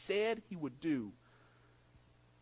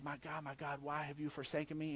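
much softer, lower anguished plea; anguished cry; 5 semitones lower, 10 dB quieter than usual. Acted. Preacher voices Jesus crying to God, softly anguished. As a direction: let it sink to a broken, quiet cry.

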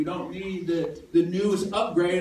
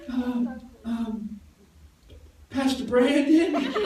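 put-on higher, hesitant little girl asking; sheepish child; 4 semitones higher, much slower than usual. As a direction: peek out and ask sheepishly. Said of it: "her little head peeks out".

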